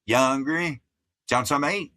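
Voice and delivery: Appalachian accent. Shown as a demonstration. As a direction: slur the words together in hillbilly dialect. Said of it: "we'd say".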